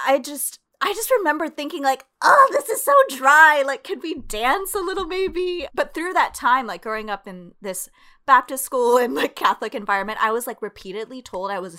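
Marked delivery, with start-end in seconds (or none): 2.2-5.7 high and loud, itching to dance; a restless kid groaning at dull mass; bored kid's complaint. Retold or imitated. Girl bored at mass, louder, whiny complaint.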